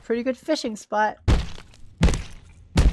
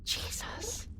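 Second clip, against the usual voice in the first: breathed out in disbelief; exasperated whisper; 12 semitones higher, 14 dB quieter than usual. Real, not acted. Gamer whispering at the worst spot in the game. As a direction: whisper it, worn out.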